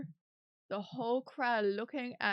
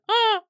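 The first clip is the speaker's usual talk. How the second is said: startled yelp; 13 semitones higher, 15 dB louder than usual. Real, not acted.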